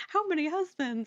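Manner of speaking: high-pitched incredulous question; mock astonishment. Officer questioning the much-married killer, astonished. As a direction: play the baffled officer, voice shooting up.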